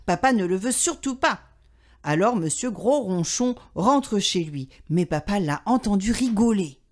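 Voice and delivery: ordinary delivery, nothing marked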